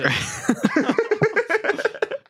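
high villain cackle. Acted. Villain laugh.

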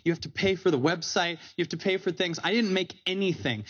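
ordinary talk

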